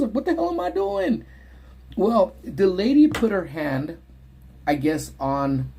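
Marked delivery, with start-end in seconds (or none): 0.1-1.2 high confused outcry; high-pitched mock confusion. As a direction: squeak it out baffled. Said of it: "Like, I'm confused".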